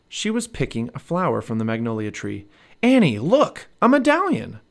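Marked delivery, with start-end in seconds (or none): 2.8-4.6 high, loud excited shout. A narrator's reading. Jack shouts to Annie about the medallion, excited. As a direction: shout to get her attention. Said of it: "shouted Jack".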